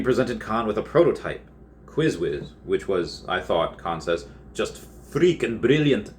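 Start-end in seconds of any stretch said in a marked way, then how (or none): 4.5-6.1 put-on a light Irish accent. Quoting Kahn's praise in a light Irish accent. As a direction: toss it off in a touch of Irish. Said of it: "I did that with an Irish accent".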